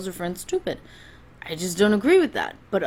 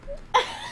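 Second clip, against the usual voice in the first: short breathy giggle; stifled laugh; 6 dB quieter than usual. Real, not acted. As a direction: break into a quick quiet chuckle. Cracks up waiting for his response.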